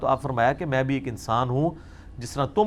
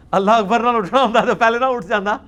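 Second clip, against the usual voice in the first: a hint of an irritated imam, higher and louder; mock grumbling imam; 6 semitones higher, 4 dB louder than usual. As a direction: tease the imam's grumpy reply. Mocking the imam's muttered retort. (بیٹھے امام کی چڑچڑی، مذاق اڑاتی آواز)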